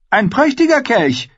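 put-on high-pitched young king, pleased; high royal proclamation; Joffrey. A narrator's reading. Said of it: "meinte Geoffrey".

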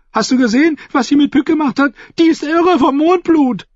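very high, agitated fool; frantic jester. A narrator's reading. The fool warns Timion that she is mad, high and frantic.

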